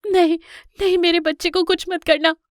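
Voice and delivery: high, loud panicked plea; frightened mother. Acted. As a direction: plead desperately to protect your child. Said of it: "माँ शेरनी बहुत डर जाती है". (डरी हुई माँ की ऊँची, घबराई गुहार)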